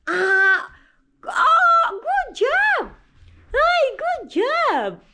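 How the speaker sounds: very high, loud praise shout; excited cheering. Real, not acted. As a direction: cheer him on at the top of your voice. Cheering toddler's kick.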